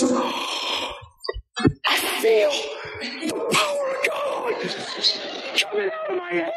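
high, faint, strained voice of awe; mock overwhelmed. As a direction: imitate someone overcome, squeezing the words out softly. Retelling man's overwhelmed reaction during healing.

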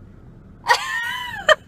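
high squealing burst of laughter; loud shrieking laugh. Real, not acted. Laughing at fight scenes.

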